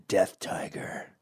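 slow, hushed whisper of a scary name; mock whisper. Gang called Death Tiger, slow mock whisper. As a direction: whisper it like a menace.

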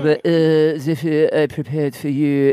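hammed-up French accent, like a chef; bad French accent. Dad pressed to perform for his kid, bad French accent. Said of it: "put on a very bad French accent".

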